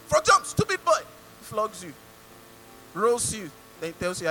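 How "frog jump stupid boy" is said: high, loud bullying command; acted angry drill order; soldier's shouted order. Acts soldier punishing a passer-by.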